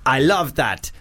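hammed-up panto Arabian accent, reading a listener email; mock Arabian accent. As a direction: read the email in a silly Aladdin-style Arabian accent. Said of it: "That was, uh, an Arabian accent".

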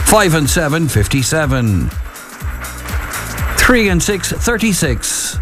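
calling the numbers with pauses; bingo caller. Acted. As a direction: sing out each bingo number, then repeat it.

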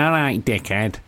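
nasal Mancunian greeting; nasal Manchester accent. Shown as a demonstration. Host copying caller's phrase, nasal Manchester accent. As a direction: push a Manchester accent through the nose. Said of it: "Real nasal, innit?".

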